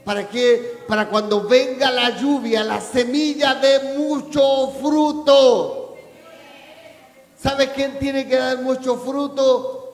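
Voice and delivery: exhortación fuerte que crece hasta el grito; proclámalo a la congregación con toda la voz; prédica a plena fuerza. Real, not acted. (predicando a voz en cuello)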